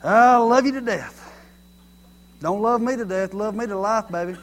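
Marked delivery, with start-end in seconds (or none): 0.0-1.3 loud, slow, higher declaration; mock gushing. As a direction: gush it with hammed-up affection. Acting out a gushing saying.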